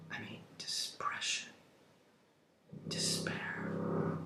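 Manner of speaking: hushed, barely voiced despair; very soft, drained of voice; despairing whisper. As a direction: let the words out as a whisper. Evoking the character's despair.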